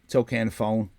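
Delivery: ordinary delivery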